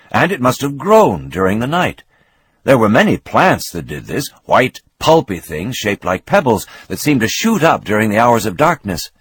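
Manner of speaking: ordinary delivery, nothing marked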